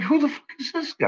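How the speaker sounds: retelling his own baffled reaction; incredulous retold question. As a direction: ask it in stunned disbelief. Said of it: "I was like".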